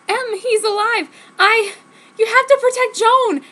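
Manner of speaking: high, loud frantic plea; desperate plea. Acted. Jenny begging Jacob to protect Joan, high and frantic. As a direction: beg him urgently.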